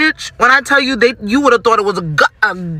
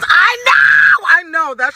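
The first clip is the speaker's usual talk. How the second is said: high, exasperated cry; exasperated shout; 7 semitones higher, slower than usual. Real, not acted. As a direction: burst out in frustration at yourself. Exasperated at herself, shouting back.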